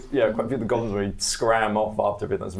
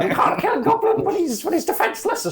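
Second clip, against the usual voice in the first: outraged goblin; 6 dB louder, much faster than usual. Acted.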